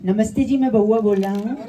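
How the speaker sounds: radio prank caller Bauaa says hello to the crowd. Shown as a demonstration. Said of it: "वो आवाज़ सुना देता हूँ".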